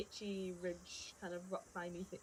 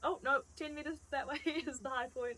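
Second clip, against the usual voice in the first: high, louder, laughing mid-quote; 7 semitones higher, 6 dB louder than usual. Retold or imitated. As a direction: act it out while laughing. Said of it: "We're like".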